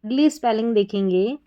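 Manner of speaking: ordinary talk, nothing marked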